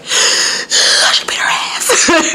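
slow, high, breathy mock near-sob; strained whispery whimper, hammed up; almost crying. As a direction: re-enact the tearful gasp, drawn right out. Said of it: "I literally did that. I was like".

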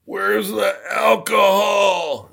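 slow, high, bellowing shout from a rowdy racer; boisterous drunken-sounding holler; loud rowdy shout. Retold or imitated. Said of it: "a real loud voice says".

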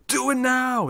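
Arnold impression. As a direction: mimic Arnie urging the kill. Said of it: "He's going full Arnold".

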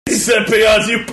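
loud, laughing shout. Real, not acted. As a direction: blurt it out mid-laugh. Laughing outburst at the reveal.

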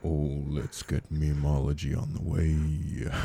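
low, deep and smooth; smooth, deep announcer tone. Real, not acted. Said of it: "I think that tone".

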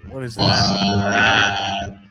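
slow, warped glitchy mic voice; distorted electronic alien sound. Real, not acted. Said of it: "some kind of alien voice there".

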